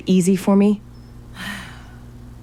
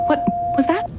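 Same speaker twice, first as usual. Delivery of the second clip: high, alarmed question without extra volume; startled; 11 semitones higher than usual. Acted. Searcher hearing the hidden room open, startled. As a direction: pitch jumps up in sudden alarm.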